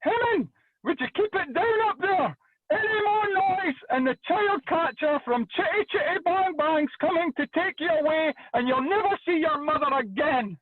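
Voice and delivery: high mock shout of a mother up the stairs; mum shouting upstairs. Mother yelling up the stairs at noisy kids, mock shrill high voice. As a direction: pitch way up as the scolding mum. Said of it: "my mum would shout".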